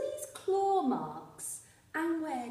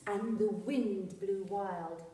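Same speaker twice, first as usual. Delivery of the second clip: usual speaking voice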